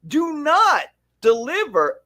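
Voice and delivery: high, drawn-out exasperated outburst; mad movie viewer. Retold or imitated. Husband and wife railing at movie promises, exasperated and high. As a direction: scold the screen, stretching the words in high-pitched outrage. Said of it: "We get so mad".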